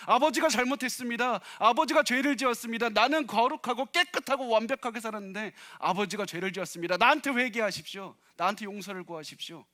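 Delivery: elder son shouting accusations at his father; angry shouting. Retold or imitated. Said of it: "이렇게 소리쳤습니다".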